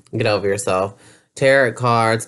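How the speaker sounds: usual speaking voice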